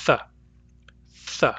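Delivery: Th and th as tongue-between-teeth hisses; th sound demo. Shown as a demonstration. Teacher drilling theta for the class, hissed. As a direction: hiss the th twice. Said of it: "tip of the tongue between the teeth".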